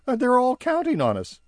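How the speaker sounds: high, fast nervous elf voice. Acted. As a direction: spill the story in a rush. Barnaby recounting.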